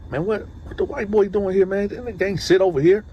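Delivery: imitating disgruntled homeboys in a high-pitched voice; indignant objection. Homeboys asking about the newcomer, indignant. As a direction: question it in a high, put-out tone.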